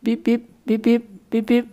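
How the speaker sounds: high mock antivirus beeping; imitated alarm beeps. Teacher mimicking an antivirus alert, high beeps. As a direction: beep like a computer warning. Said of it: "it's like computer, antivirus".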